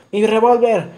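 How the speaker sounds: frightened landowner shouting for his revolver; panicked shout. Acted. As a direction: yell it out in a panic while running. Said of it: "corrió gritando".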